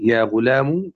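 يا غلام ending in a short "u", ghulamu; final damma. Shown as a demonstration. Teacher showing students three vowels on the meem, "u" ending on يا غلام. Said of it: "أي بثلاث حركات على الميم".

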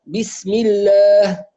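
الله with a thin lam after the kasra, slowly; teacher showing the light lam. Shown as a demonstration. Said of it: "وترقق إذا سبقت بكسر".

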